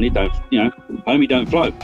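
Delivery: normal talking voice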